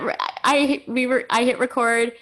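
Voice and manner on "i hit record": ordinary talk, nothing marked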